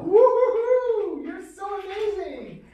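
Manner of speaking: very high, slow, loud woo-hoo then squeal; gushing sky-high praise; high-pitched cheer. Real, not acted. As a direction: celebrate the dog up high. Praising dog in training.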